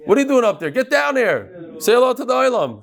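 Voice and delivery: playful summons from across the room; teacher calling a student down to the front. Real, not acted. Teacher calls boy down.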